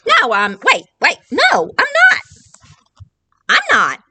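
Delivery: high flustered storybook voice; flustered protest. Acted. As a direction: correct them in a fluster.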